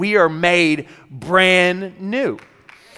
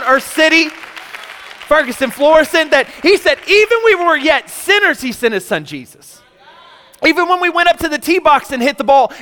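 full-force preaching; 4 semitones higher than usual. Acted. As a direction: preach it to the congregation with rising fire. Preaching about grace.

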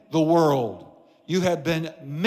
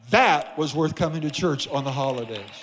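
ordinary delivery

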